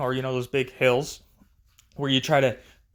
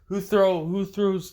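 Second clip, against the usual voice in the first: higher-pitched imitation of a yelling man; half-hearted retold scream, pitched up; mock yelling; 6 semitones higher than usual. Angry neighbor confronts kids over thrown snowballs, mock yelling. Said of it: "this guy comes over yelling and screaming".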